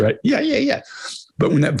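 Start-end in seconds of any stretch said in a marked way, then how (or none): 0.2-0.8 very high, squeaky comic voice; Three Stooges impression. Analyst mimicking slapstick comedians, squeaky high-pitched voice. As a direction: pitch it way up, comic triple yeah. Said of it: "you hear the Three Stooges".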